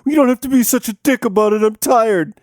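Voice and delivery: put-on high, fast whine of a sulky teen; whiny student. Sleepy student whines back at the teacher who scolded him. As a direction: complain like a tired teen caught out. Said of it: "You're interrupting my class".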